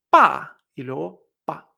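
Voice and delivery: slow "pa" falling hard, then a light short "pa"; syllables shown separately. Shown as a demonstration. Teacher breaking down dad, first "pa" strong, last "pa" light. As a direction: hit the first "pa", release the last "pa" unstressed. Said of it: "el cuarto tono y el sin tono".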